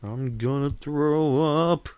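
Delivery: slow, stretched and queasy cat voice. Retold or imitated. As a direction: drag it out like a sick cat warning you. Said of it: "he's letting you know".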